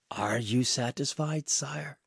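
soft, calm question; quiet question. A narrator's reading. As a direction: ask the king quietly but firmly. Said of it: "although he spoke quietly".

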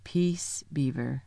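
soft, low warning growl; hushed lion's warning. A narrator's reading. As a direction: quietly growl a rebuke, dropping down. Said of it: "with a very low growl".

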